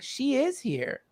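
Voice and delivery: a sage gently tells the grieving guest her mother is near; slow, soft quote. Retold or imitated. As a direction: let it land softly and surely. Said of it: "He's like".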